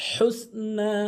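হুসনা with the heavy throaty হ; big ha demo. Shown as a demonstration. Teacher models the correct big ha for listeners. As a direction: breathe the হ in হুসনা from mid-throat. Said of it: "বড় হা উচ্চারণ করতে হবে".

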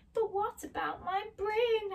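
high-pitched plaintive question; pleading Scarecrow. Acted. Scarecrow asks wizard about his brain, pleading. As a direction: ask it high and drawn upward.

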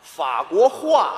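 slow, clear 法国话, ending properly in hua; careful correction. Shown as a demonstration. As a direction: sound out 法国话 slowly for a poor pupil.

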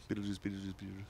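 fast three times. Shown as a demonstration. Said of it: "Say it real fast three times".

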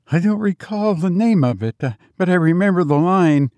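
put-on high-pitched, mildly hesitant old servant; faltering old servant. A narrator's reading. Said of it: "faltered Biggs".

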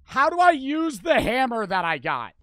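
loud, high panicked shout; panicked yelling. Real, not acted. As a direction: yell it in panic. Panicking at game.

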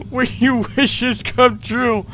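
slow, drawn-out mock welcome; mock grand announcement. Player mocking the hall's welcome sign, slow and grand. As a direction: savour it like a cheesy host.

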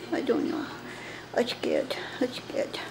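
whispered, scared; frightened whisper. Acted. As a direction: whisper fearfully so mom won't hear. Hiding, frightened.

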